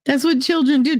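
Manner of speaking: usual speaking voice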